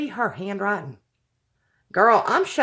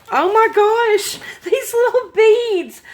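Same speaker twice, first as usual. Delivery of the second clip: much higher, louder, slowed gush of delight; excited squeal; 11 semitones higher, 7 dB louder than usual. Real, not acted. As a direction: squeal over the tiny beads. Excited over beads in the box.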